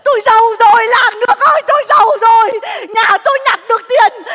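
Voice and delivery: loud, high wailing cry of joy; joyful shouting. Acted. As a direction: shout it to the whole village. Wife shouting about sudden wealth.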